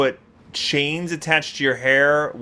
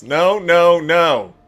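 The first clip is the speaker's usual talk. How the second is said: louder, firm refusal; emphatic no; 2 semitones higher, 6 dB louder, much slower than usual. Real, not acted. Rejecting the female hairstyles, loud emphatic no. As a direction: stamp each no down hard. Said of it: "Emphatic no".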